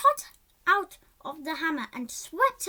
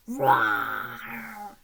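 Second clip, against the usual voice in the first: much lower, drawn-out monster roar; 13 semitones lower, 3 dB louder than usual. Acted. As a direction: roar like a giant in the woods. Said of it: "a terrifying roar echoed".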